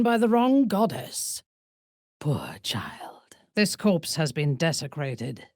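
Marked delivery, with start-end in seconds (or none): 2.2-3.4 low, soft, voiceless; pitying whisper. Acted. A dark elf whispering pity to the player.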